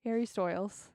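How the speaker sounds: "Stoyles" with OY in place of long I; understated "Stoyles", rhyming with boils; DJ mispronunciation. Retold or imitated. Echoing the DJ's baffling error, "Stoyles" with OY. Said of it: "You can't pronounce it based off of how it's spelled".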